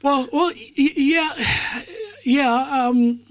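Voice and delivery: high laughing groan at the memory. Real, not acted. Laughing at being under skin.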